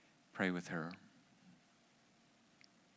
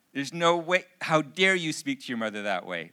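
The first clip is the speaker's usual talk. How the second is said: loud, raised-pitch scolding; indignant dad; 5 semitones higher, 9 dB louder than usual. Retold or imitated. Father inwardly reacts to daughter's rudeness to mom, indignant scolding. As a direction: act out a father's outrage. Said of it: "everything in me".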